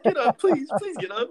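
very high, breathy begging; desperate pleading. Retold or imitated. Scared freshman begging teammates to stand up, very high desperate plea. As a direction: beg them to stand before she erupts. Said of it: "I'm like".

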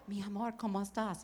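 very soft, tender murmur. Retold or imitated. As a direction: ask gently, like a worried husband. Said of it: "muy tierno". (el esposo le habla con mucha ternura)

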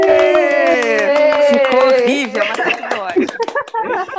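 high, loud and gleeful; cheer, laughing at the flop. Real, not acted. Cheering after failed chorus.

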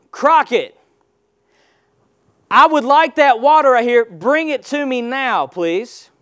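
calling for it boldly; firm summons. Shown as a demonstration. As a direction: summon it by name, with authority. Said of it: "If I go and I say".

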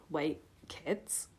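low, hushed disbelief; breathed question. A narrator's reading. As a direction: drop to a soft, low murmur. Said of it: "he breathed".

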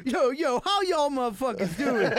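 high, loud hype-man greeting; loud mock stage shout; hyped stage greeting. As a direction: work up the crowd from the stage. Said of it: "he's like".

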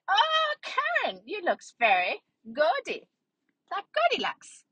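very high, drawn-out acted ooh; high-pitched, stretched-out mock amazement; exclaiming in wonder. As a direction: coo slowly at a friend's new look. Acted gushing reaction on seeing Karen.

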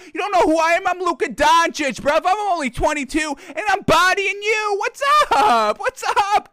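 high, loud cocky taunting; mock trash talk. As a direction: strut and taunt the smaller guard. Imitating Luka trash talking Beverley.

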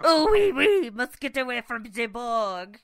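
high, loud hammed-up French accent. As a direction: ham up a panicky French accent. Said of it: "French joke bit".

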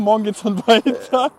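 normal talking voice

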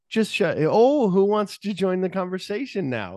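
sarcastic sing-out, pitched well up, stretching the "oh"; mocking retort. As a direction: taunt them with an exaggerated high "oh". Acting out Julie's catty retort.

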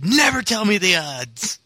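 high, slow cocky voice; Han Solo voice. Retold or imitated. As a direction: toss it off cocky. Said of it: "He just".

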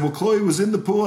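wife's worried voice. Retold or imitated. Retelling wife's news on phone.

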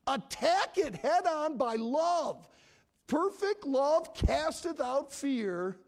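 high, loud preacher's exhortation; urgent full-force sermon voice; forceful preaching. Real, not acted.

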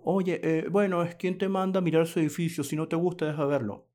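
a mild mock of a snippy defensive retort; mocking defensive attitude. Imitating a defensive reply to a building description, mildly mocking. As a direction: imitate someone brushing you off defensively. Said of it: "Eso es una actitud a la defensiva".

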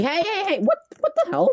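high, indignant squawk of protest; outraged protest. Real, not acted. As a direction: cry out in shocked disbelief. Friend skips his favourite topic.

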